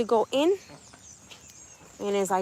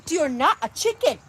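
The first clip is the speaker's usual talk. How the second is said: mock insistence; 4 semitones higher than usual. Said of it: "And this is how it".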